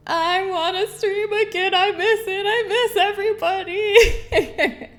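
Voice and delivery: hammed-up longing for chat; mock whine. Retelling missing her stream, mock whiny. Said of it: "I was like".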